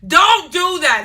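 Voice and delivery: loud and firm; loud warning. Real, not acted. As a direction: scold the viewers firmly. Emphatic warning to viewers.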